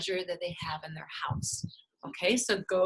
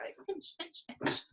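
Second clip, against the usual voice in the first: whispered "pinch, pinch"; soft pinch demo; 8 semitones higher, 10 dB quieter than usual. Shown as a demonstration. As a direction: breathe "pinch" softly, like a tiny pinch. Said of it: "I like pincher better".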